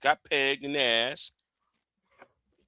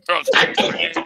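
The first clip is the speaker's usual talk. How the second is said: laughing; 4 semitones higher, 9 dB louder than usual. Real, not acted. Laughing off the flirting.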